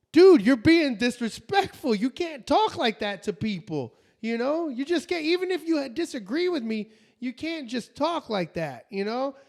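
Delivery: mock-earnest telling-off; retold confrontation. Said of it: "I sound like a caveman talking".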